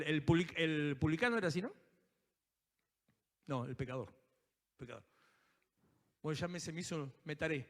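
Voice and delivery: ordinary talk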